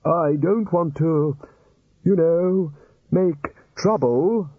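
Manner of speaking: put-on high, slow pleading old wizard; wistful old wizard. Wistful plea from chair.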